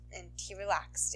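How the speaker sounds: ordinary delivery, nothing marked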